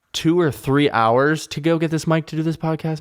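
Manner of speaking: ordinary talk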